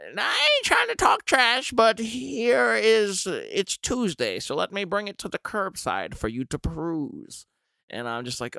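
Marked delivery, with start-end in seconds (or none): 0.1-7.4 sugar-coated dig; passive-aggressive neighbor. Retold or imitated. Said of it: "She's like".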